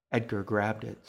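usual speaking voice

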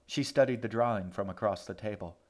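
ordinary delivery, nothing marked

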